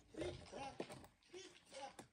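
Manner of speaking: high, soft tripping; small light trip-trap steps. A narrator's reading. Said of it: "Den var så tynn og fin i målet".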